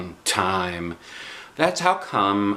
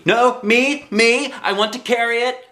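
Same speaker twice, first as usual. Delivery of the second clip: high, loud demanding; hollered; 13 semitones higher, 4 dB louder than usual. A narrator's reading. Junie B hollering to carry the cake herself, high. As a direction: insist loudly like a kid. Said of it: "I hollered".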